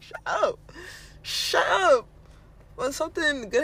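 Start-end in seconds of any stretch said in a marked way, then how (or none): 0.0-2.1 mock dismissal. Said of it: "I just look at them like".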